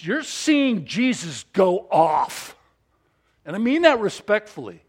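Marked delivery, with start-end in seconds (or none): 0.0-2.6 slowed, emphatic. Retold or imitated. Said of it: "I used to say to college students".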